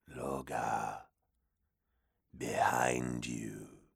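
low, slow whispered warning; demon whisper. Acted. Demon warns.

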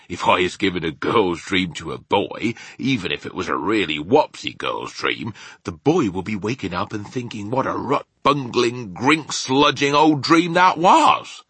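big giant explaining, then exclaiming; rustic giant character. Acted. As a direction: voice a rambling giant, building to indignation.

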